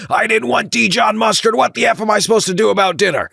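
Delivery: hammed-up furious caller shouting; retold screaming. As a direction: imitate the customer losing it. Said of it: "he began screaming again".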